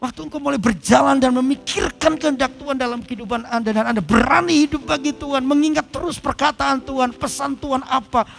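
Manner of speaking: preaching. Acted. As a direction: drive the word home to the church. Preaching at full force. (khotbah berapi-api dengan suara tinggi)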